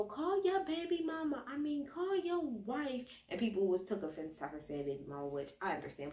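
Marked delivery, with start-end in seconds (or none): 0.0-3.1 higher, slow tipsy woman voice; mock drunk Erica. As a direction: play the drunk friend pushing him.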